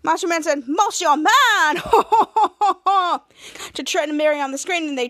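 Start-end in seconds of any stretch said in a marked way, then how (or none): 0.7-1.8 louder, drawn-out name call; heroic announcement. Retold or imitated. Hero introducing himself to everyone, grand loud call. 1.8-3.2 loud staccato ho-ho laugh; hero's mock laugh. Hero after announcing himself, loud put-on laugh.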